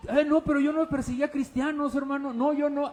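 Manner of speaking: high-pitched objection in a listener's voice; acted-out denial; indignant protest. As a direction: protest your innocence, pitched well up.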